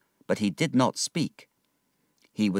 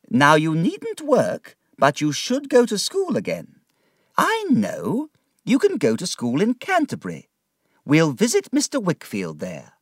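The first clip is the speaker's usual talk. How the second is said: put-on higher, decided old aunt voice; brisk aunt; 8 semitones higher, 4 dB louder than usual. Aunt deciding David's schooling, brisk. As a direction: set out the plan firmly.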